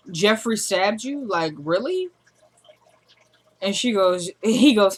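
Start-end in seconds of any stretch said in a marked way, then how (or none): 0.1-2.1 slow, quiet disbelief rising at the end; sceptical. Retold or imitated. Husband doubting his wife's claim, slowly sceptical. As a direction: draw the question out doubtfully.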